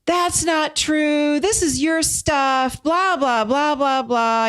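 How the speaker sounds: high, slow mocking take on her husband's protest; hammed-up husband brushing off her point; imitating husband. Imitating Graham pushing back on her, high and mocking. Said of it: "when he would come back at me and say things like".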